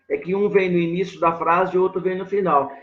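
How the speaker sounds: ordinary delivery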